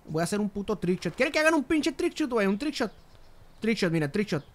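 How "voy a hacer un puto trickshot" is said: normal talking voice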